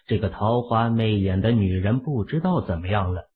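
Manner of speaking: usual speaking voice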